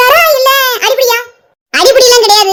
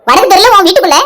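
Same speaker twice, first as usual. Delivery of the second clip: ordinary delivery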